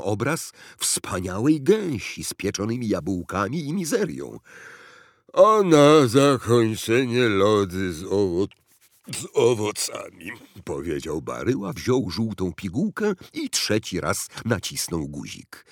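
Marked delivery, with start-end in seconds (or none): 5.3-10.3 loud, laboured voice stumbling on a word; fat, gluttonous president. A narrator's reading. President orders dessert by pill, laboured and greedy. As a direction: play the greedy president, heaving out words.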